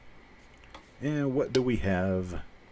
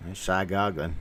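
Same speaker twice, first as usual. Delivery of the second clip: Appalachian twang; Appalachian accent. Shown as a demonstration. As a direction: say it like West Virginia hill folk. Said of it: "That's how they talk in West Virginia".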